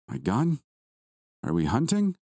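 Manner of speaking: puzzled questions climbing high at the end; confused. A narrator's reading. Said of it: "He sounded confused".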